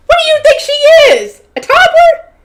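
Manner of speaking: high, loud laughing disbelief; incredulous outburst. Real, not acted. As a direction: burst out laughing, pitch shooting way up. Reader reacts incredulously to "playground.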